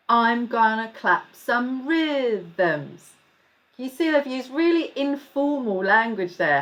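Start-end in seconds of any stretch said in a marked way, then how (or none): 0.1-3.1 very slow, each word of I'm gonna clap some rhythms spaced out; slow read-through. Shown as a demonstration. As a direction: read the rap line slowly for kids. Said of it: "a lot more slowly".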